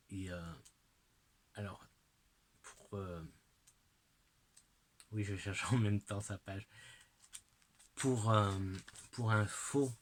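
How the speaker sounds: ordinary delivery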